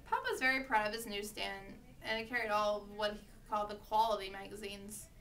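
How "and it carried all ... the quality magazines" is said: ordinary delivery, nothing marked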